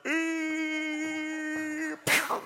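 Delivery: long high squeal ending in a pew; cartoon crash noise. Preacher replays the old lady's crash, long high squeal. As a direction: imitate a cartoon tumble and smack.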